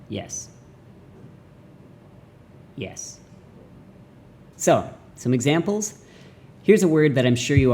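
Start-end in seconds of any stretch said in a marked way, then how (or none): 0.1-0.5 "Yes" very soft, low and short; bored tone. Shown as a demonstration. Teacher demonstrating fifth tone, "Yes" soft, low and bored. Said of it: "bored tone". 2.8-3.2 "Yes" very soft, low and short; bored tone. Shown as a demonstration. Teacher demonstrating fifth tone, "Yes" soft, low and bored. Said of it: "bored tone".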